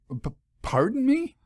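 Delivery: high startled stammer; stammering surprise. A narrator's reading. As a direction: catch your breath, baffled.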